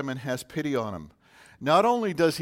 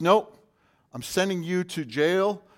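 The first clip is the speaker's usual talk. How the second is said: loud, hard refusal; harsh refusal; 5 semitones higher, 5 dB louder than usual. Retold or imitated. The servant loudly refuses his fellow servant and sends him to jail. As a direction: slam the door on him. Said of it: "And the servant says".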